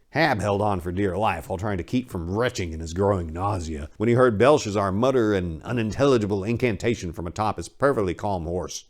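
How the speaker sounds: usual speaking voice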